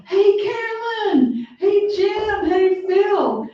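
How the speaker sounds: high-pitched, drawn-out call to friends; imitated calling out. President greeting old pals in the crowd, imitated yell. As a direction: mimic a pal hollering names over a crowd. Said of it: "and he yells out".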